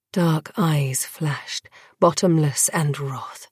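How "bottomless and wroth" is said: ordinary delivery, nothing marked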